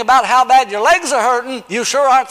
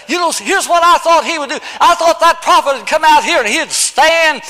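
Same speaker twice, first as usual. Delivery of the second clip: indignant Naaman; 7 semitones higher, 4 dB louder than usual. Retold or imitated. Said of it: "That's what Naaman said".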